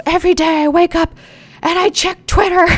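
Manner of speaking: high, loud put-on whine; hammed-up Twitter complainer; mock whiny meme voice. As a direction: moan it like a Twitter addict. Acting out meme caption.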